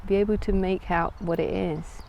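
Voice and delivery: calm, unhurried guided meditation voice. Acted. Yoga teacher leading listeners into a floating visualisation, calm meditative delivery. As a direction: guide them inward with long pauses between phrases.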